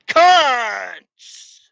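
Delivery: sudden full-volume high scream; screamed insult. Retold or imitated. Screaming the insult into coworkers' ears, full shout. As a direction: blast it right into their ears. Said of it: "I said it right into their fucking ears".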